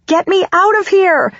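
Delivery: high, desperate cry to escape; panicked scream. A narrator's reading. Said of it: "I scream in a panic".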